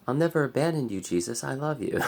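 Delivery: a milder take on the disciple's plea; toned-down Peter voice. Retold or imitated. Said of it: "That's better".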